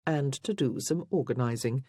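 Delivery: usual speaking voice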